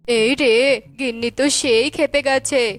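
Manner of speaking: low, anxious husband muttering; worried husband voice. Acted. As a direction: fret about the angry wife. Husband Tona worried aside.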